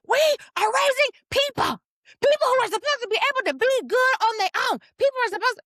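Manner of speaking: very high, loud imitation of ranting; worked-up rant. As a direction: mimic a friend off the wall, voice way up.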